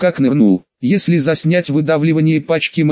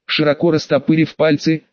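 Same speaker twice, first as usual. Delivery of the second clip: ordinary delivery, nothing marked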